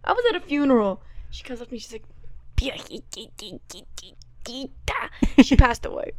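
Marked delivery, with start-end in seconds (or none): 2.6-4.7 soft unvoiced whisper, drawn out; whispered pet name. Aunt at a funeral whispering to her niece.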